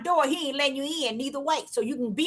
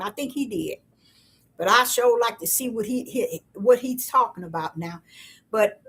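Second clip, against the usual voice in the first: usual speaking voice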